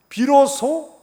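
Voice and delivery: sudden high, loud emphasis on 비로소; emphatic cry. Acted. Emphatic sermon climax.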